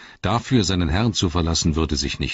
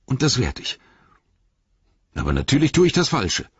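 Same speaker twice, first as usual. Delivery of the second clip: higher-pitched, agitated thinking aloud; troubled hobbit weighing the stakes; Sam, resolving anxiously; 5 semitones higher than usual. A narrator's reading. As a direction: argue yourself into a hard decision.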